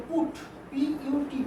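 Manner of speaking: slow, soft letter-by-letter spelling of পুট; quiet slow dictation; spelling out put. Shown as a demonstration. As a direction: spell পুট gently, one letter at a time. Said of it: "পুট লেখো".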